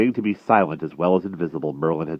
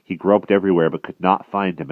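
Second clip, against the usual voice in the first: ordinary delivery, nothing marked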